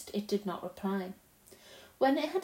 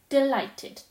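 "Delighted" said up and louder, falling at the end; 4 semitones higher, 6 dB louder than usual. Acted. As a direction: exclaim "Delighted" with glee. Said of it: "it went on".

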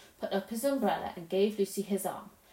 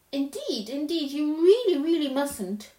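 anxious insisting; 5 semitones higher, 6 dB louder than usual. Acted.

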